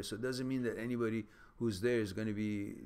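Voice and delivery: usual speaking voice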